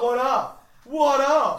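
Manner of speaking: hyped shout. Pretend. Co-host crashing the listener's email, hyped shout. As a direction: burst in with a big laughing yell. Bursts in with mock hype greeting.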